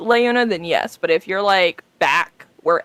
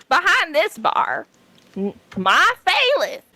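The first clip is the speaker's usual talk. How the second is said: put-on much higher old granny voice; grandma voice; 9 semitones higher than usual. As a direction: play a fussy old grandma behind the bar. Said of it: "I get to do my grandma voice".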